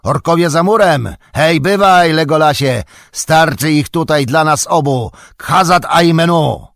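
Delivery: loud, high-pitched battle call; shouting. A narrator's reading. Gimli calling Legolas to fight the orcs, shouting. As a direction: shout to rally a friend over the fighting. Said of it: "krzyknął Gimli".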